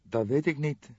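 a mild wail of helplessness. Acted. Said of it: "sprak ze jammerend". (licht jammerend, hogere stem)

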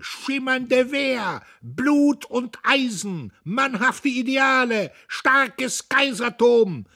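very high, slow, overstrained shouting; rattling power slogans. A narrator's reading. Dietrich shouts slogans over the din, bombastic. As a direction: hurl each slogan out at full stretch. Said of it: "Seine Kraftworte stießen rasselnd aneinander".

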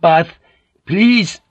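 two separate words, high and loud; insist, one word at a time; high, loud final demand; with finality. A narrator's reading. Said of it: "said with finality".

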